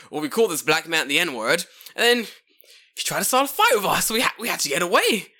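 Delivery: high, loud, frantic excuse; hammed-up panicked pleading; panicking kids. Kids explaining themselves to the cops, mock panic. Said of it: "they're freaking out".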